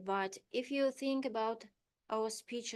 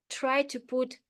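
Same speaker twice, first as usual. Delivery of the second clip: usual speaking voice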